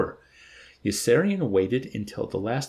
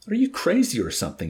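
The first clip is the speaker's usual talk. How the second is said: much higher, louder, faster shout; 9 semitones higher, 4 dB louder, faster than usual. A narrator's reading. Dobbs shouting at Yossarian, incredulous shout. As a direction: yell it in disbelief. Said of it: "shouted Dobbs".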